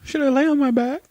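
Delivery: very high, squeaky-sweet put-on voice; mock naive eagerness. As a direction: play a gullible little thing in falsetto. Mocking an eager, naive prey.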